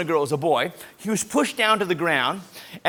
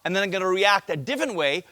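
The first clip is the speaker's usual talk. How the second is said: ordinary talk, nothing marked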